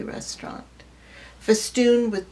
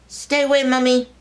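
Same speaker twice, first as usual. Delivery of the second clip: put-on child's defiant shout; 4 semitones higher, 6 dB louder than usual. Child refusing from under table.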